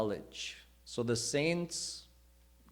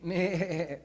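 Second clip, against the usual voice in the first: bleating sheep; 5 semitones higher than usual. Retold or imitated. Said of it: "that lion grew up going".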